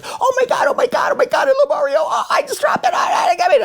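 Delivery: high-pitched screaming fan, way up in the voice; mock fan shriek, very high. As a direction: shriek like a hysterical fan who just caught a drumstick. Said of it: "this lady screaming".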